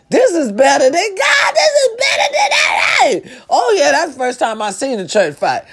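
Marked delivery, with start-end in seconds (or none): 0.1-2.9 high, climbing excited shout; excited exclamation. Retold or imitated. Retelling her reaction to a church fight, excited high shout. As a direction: cry it out, shooting up in pitch. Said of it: "I said".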